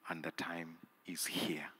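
hushed whisper. Acted. Preacher whispering to the congregation that the time is here. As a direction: drop to a breathy whisper for effect.

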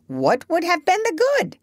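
high-pitched parrot retort; high, dismissive bird voice; bossy parrot. A narrator's reading. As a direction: answer back as a know-all bird.

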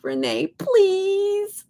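high, drawn-out mock plea; playful begging. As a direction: beg jokingly, stretching each please. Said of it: "I was just kidding".

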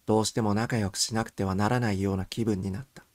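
ordinary delivery, nothing marked

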